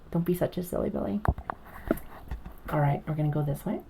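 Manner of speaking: ordinary talk, nothing marked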